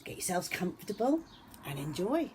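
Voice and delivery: ordinary delivery, nothing marked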